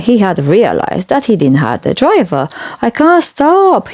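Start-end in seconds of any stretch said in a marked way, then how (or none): 2.8-3.8 high, panicky cry; frightened wail. A narrator's reading. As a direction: wail it as he runs away out of control. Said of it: "he wailed".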